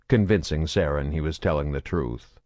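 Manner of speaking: usual speaking voice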